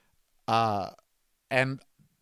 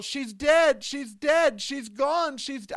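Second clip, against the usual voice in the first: much higher, mock sobbing cry of grief; imitating grief-stricken wail; 9 semitones higher, slower than usual. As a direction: retell his anguished wail, pitched well up. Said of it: "he's all".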